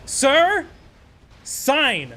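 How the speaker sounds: mock clerk, loud and high; barking clerk. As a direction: snap the order like an impatient clerk. Clerk ordering to sign.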